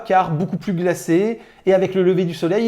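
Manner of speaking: ordinary talk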